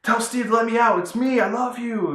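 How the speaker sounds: mock pleading madman, pitched high; crazed pleading. Comic book madman begging Diana to free him, high mock pleading. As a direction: beg to be let out like a raving lunatic. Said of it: "He's going like".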